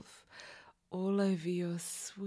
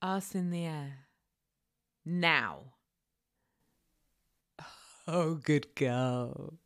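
cold command; 6 semitones lower than usual. Acted. A cruel mistress ordering her captive, cold and low. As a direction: give orders coldly, savouring control.

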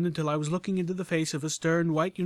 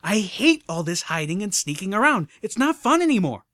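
loud, higher frustrated rant; frustrated growl; 7 semitones higher, 8 dB louder than usual. Acted. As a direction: let the frustration boil over. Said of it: "Scootaloo growled with frustration".

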